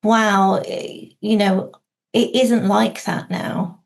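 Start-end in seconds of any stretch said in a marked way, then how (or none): none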